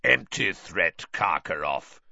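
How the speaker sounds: put-on gruff old Auror; growled. A narrator's reading. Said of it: "growled a voice".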